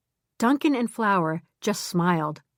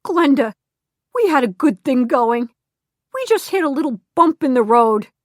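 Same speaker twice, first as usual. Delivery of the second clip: higher, louder pleading with a cracking voice; 5 semitones higher, 6 dB louder than usual. Acted. Ex Harvey pleading with Glenda on the phone, voice cracking. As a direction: beg her to take him back. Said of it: "Harvey's voice cracked".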